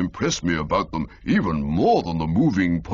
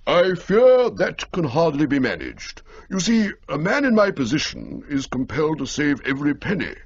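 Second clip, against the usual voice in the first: higher, regretful and stately; a stiff uncle declining; pompous old gentleman; 9 semitones higher, 3 dB louder than usual. Acted. Old Little to Bertie, lightly voiced pompous regret.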